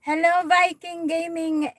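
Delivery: bright high greeting to a new viewer; excited greeting. Real, not acted. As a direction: call out hello, up and loud. Greeting viewer on live stream.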